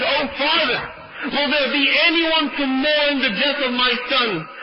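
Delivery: high, anguished mother's cry; retold weeping plea. Mother asks who will mourn her son, weeping plea. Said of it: "starts weeping even more and more".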